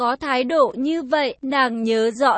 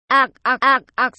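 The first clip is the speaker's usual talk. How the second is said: louder, rasping strangled gurgles; choking gasps; 5 dB louder than usual. Acted. Ngọc pinned down and throttled, strangled gasps. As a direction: sputter as if a hand is on your throat.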